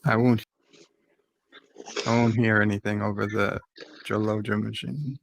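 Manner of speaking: ordinary talk, nothing marked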